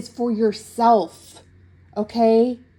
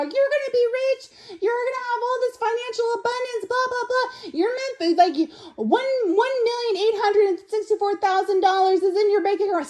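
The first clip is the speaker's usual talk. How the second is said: much higher, sing-song hype voice mocking manifestation videos; mock TikTok guru; 12 semitones higher than usual. Tarot reader mocks TikTok manifestation videos to viewer, high hyped-up parody. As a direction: parody a breathless money-manifesting influencer. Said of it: "those stupid freaking videos on TikTok".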